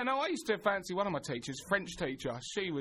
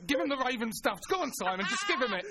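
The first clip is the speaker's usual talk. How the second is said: mock urging; 6 semitones higher than usual. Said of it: "He's going".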